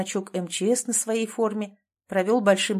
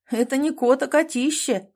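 raised pitch, mildly amused remark; wry amusement; 5 semitones higher, 4 dB louder than usual. A narrator's reading. Rescuer sizing up the huge cat, wryly amused. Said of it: "хмыкнул Клим".

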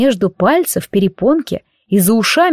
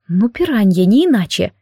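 plain reading voice

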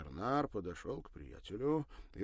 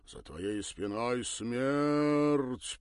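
very slow, drawn-out grave voice; sepulchral voice; 5 semitones higher, 9 dB louder, much slower than usual. Acted. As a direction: intone it from beyond the grave. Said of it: "протянул загробным голосом".

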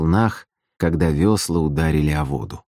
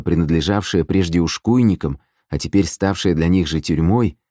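plain reading voice

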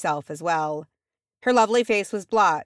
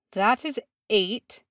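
slow, raised, icy statement; measured, icy correction; 5 semitones higher, slower than usual. A narrator's reading. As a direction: say it slowly, cold as ice. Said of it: "in a voice of ice".

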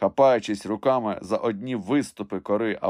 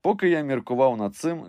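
ordinary delivery

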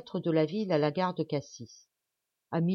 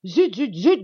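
loud, higher burst of annoyance; three hard-hit exclamations of exasperation; scolding growl; 5 semitones higher, 12 dB louder, slower than usual. A narrator's reading. Doctor to his maid who let journalists in, angry growl. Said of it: "gronda Alburtin".

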